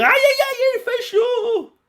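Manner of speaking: very high, loud, slow yelp; hammed-up ouch. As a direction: yelp at the burning heat. Goku burned by heat.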